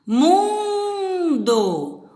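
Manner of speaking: "Mundo" high, with MUN held long; MUUUN-do, called out high to show the stress. Shown as a demonstration. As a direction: stretch the first syllable of "Mundo". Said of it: "Onde que tá?".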